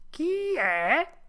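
tiny high-pitched voice; little voice. A narrator's reading. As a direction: call out squeakily from indoors. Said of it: "disse una vocina di dentro". (vocina acuta da dentro casa)

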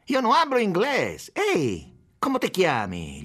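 high-pitched heckling shout at the start. A narrator's reading. Second policeman butting in to demand his name, shouting. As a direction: call out roughly, starting high and easing down. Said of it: "gli gridò qualcun altro".

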